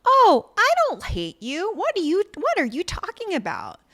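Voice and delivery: high, exaggerated mock-reassuring voice; mock reassurance. Man giving her the validation she wants, mock reassuring. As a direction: pitch it way up, overdone denial. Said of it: "She wants him to say".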